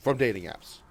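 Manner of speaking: ordinary delivery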